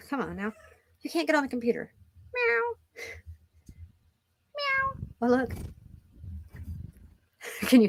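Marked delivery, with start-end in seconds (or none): 2.3-4.9 high, soft meow; mock meow. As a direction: meow softly like a kitten. Voicing the cat.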